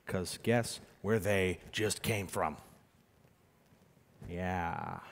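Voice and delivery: slow, hushed and grave; slow hushed punchline; slow somber reveal. Real, not acted. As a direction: let the dark punchline land quietly. Somber punchline about funeral.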